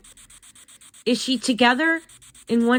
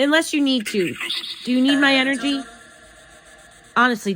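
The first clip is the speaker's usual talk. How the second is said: ordinary delivery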